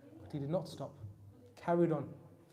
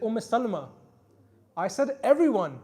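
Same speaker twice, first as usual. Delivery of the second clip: louder call through the door; 5 semitones higher, 8 dB louder than usual. Retold or imitated. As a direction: call out to his wife behind the door. The cry came from inside the door.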